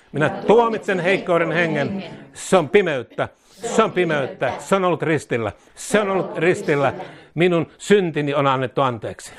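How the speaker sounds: fervent prayer, each phrase said twice; leading a call-and-repeat prayer. Acted. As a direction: lead the congregation line by line in prayer. Leads repeat-after-me prayer.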